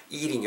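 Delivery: "eating" with lips pulled wide for a long ee; wide, tense ee opening "eating"; spread-lipped vowel. Shown as a demonstration. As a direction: stretch the mouth sideways on "eating". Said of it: "입의 양옆을 쫙 옆으로 째면서".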